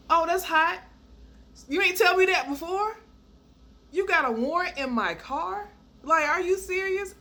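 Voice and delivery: high, loud disbelief; sarcastic, scandalized retort; incredulous outrage. Retold or imitated. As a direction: react aghast, pitch way up. Acted disbelief at warrant news.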